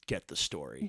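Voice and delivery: low, soft inner voice egging him on; inner comedian voice. Retold or imitated. Comedian's inner voice urging him, low and soft. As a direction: mutter like a devil on the shoulder. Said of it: "the comedian in me is like".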